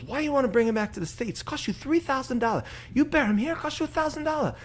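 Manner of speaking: mocking the priest's voice in the joke; Filipino accent. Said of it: "Dude, your accents".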